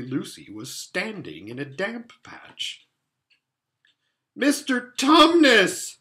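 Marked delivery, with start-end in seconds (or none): 4.4-6.0 very loud, high, drawn-out yell; bawling. A narrator's reading. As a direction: bawl the name right in his ear. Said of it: "bawled Lucy in his ear".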